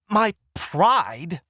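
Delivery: high indignant shout. Acted. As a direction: shout it back, outraged. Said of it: "She's shouting now".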